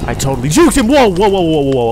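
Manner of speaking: high, loud outburst; loud boast turning to alarm. Real, not acted. Player boasts of juking the angel, then alarmed yelling. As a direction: crow it, then yelp in alarm.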